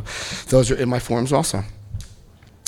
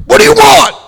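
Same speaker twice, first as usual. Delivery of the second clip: much higher, much louder gruff bark; sergeant yelling; 10 dB louder than usual. Retold or imitated. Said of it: "the big sergeant goes".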